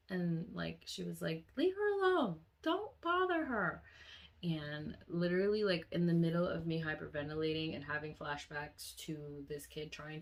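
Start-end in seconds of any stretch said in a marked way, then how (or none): 1.6-3.8 high, raised motherly reprimand; mom scolding. Retold or imitated. Mom telling Jordan to leave her, high scolding voice. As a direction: imitate a mother calling her son off, high.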